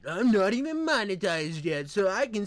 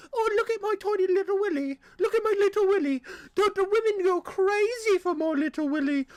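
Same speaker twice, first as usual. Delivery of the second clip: much higher, fast, silly posh British voice; mock British accent; 13 semitones higher than usual. Mocking the British, a high silly British voice. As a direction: prance about as a smug Brit. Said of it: "They're just probably a whole country".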